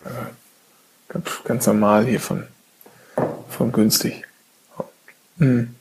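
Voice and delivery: words round a spoonful of ice cream; chewing while talking. Real, not acted. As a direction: mouth full of ice cream. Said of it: "ich esse grad Eis".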